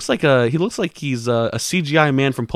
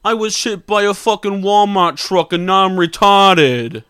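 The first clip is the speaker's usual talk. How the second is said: Tracy Morgan; 6 semitones higher, 5 dB louder, slower than usual. Acted. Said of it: "it's me, Tracy Morgan".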